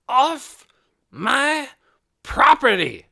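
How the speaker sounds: loud, high furious shout. A narrator's reading. Hoyt shouting at the crowd on his driveway. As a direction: drive them off his land with a roar. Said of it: "he shouted".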